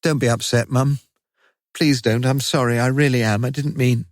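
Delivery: put-on a boy begging his mum not to cry; pleading, sorry. A narrator's reading. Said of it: "conscious of a long-forgotten love for her".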